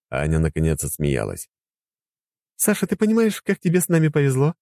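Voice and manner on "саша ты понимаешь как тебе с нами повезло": higher woman's voice; fond wife's question. Acted. Anya voiced. (женский голос)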